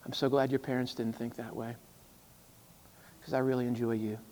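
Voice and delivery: soft, sincere and hushed; quiet kindness to a friend who surprised you; tender reply. Retold or imitated. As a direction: say it gently to someone you like. Tender words to Chad.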